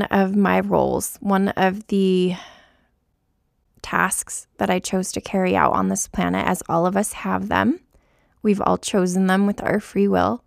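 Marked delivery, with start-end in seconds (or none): none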